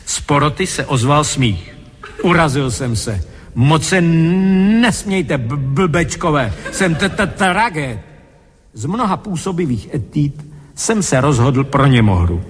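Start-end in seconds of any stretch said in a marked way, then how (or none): 3.6-8.0 offended stammer, stuck on first sounds; stuttering. Acted. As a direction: stutter indignantly, dragging out the blocked words. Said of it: "moje vrozená koktavost nebude na závadu". (dotčené koktání)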